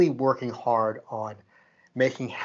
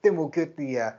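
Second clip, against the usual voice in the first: higher, eager run through the trip plans; bubbly itinerary rundown, pitched up; excited planning; 5 semitones higher than usual. Retold or imitated. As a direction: reel off plans with building excitement. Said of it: "we were so excited to go".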